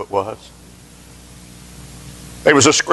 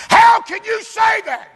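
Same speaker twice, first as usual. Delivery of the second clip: shouted outcry. Retold or imitated. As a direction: cry it out in disbelief. Preacher crying out at boy.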